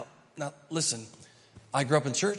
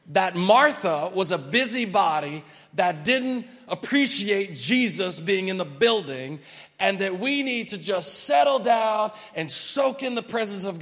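louder, rising sermon delivery; preaching; 6 semitones higher, 7 dB louder than usual. Acted. Pastor preaches with building force to his congregation. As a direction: drive the point home to the congregation.

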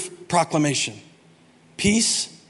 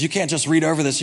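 ordinary delivery

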